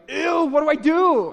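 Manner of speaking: high, loud, disgusted yell for help; retold panicked scream. Uncle yelling to his sister about the spitting nephew, panicked shout. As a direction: shriek it across the room in disgust. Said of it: "I just screamed at her".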